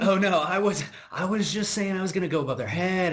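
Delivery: a hint of an Australian guy backpedalling; Australian accent barely attempted; half-hearted Australian accent. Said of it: "I can't do an Australian accent".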